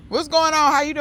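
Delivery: bright high-pitched hello to a visitor; high sing-song greeting. Real, not acted. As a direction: pitch jumps way up, cooing hello. Greeting someone off camera.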